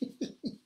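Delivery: high breathless hysterical giggle; hysterical laugh. A narrator's reading. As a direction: let the laugh break loose. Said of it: "part d'un rire hystérique".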